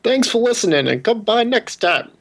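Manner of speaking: high, over-the-top Walken voice with odd pauses; hammed-up Walken sign-off; Christopher Walken impression. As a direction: carnival huckster pitch in a Walken voice. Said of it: "our best Christopher Walken".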